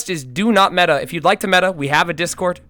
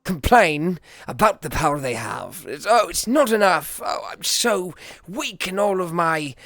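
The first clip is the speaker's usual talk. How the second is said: low, soft brooding loner voice with a put-on accent; bitter outcast muttering his scorn; Joseph's bad accent; 3 semitones lower, 5 dB quieter than usual. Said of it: "Joseph was a depressed asshole with a bad accent".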